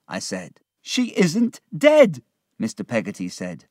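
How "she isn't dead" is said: high, loud, drawn-out exclamation; overwhelmed relief. Acted. Peggotty realises Emily is alive, overwhelmed relief. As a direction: cry it out slowly in relief.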